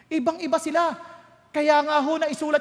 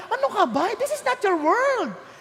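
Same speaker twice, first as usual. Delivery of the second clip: ordinary talk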